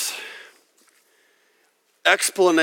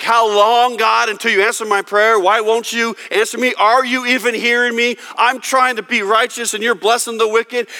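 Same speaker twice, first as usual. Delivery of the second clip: higher and louder, pleading and exasperated; frustrated cry to God; 8 semitones higher, 4 dB louder than usual. Retold or imitated. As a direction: cry out at God who won't answer. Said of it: "He was mad at God and was like".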